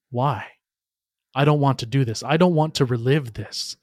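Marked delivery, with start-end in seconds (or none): none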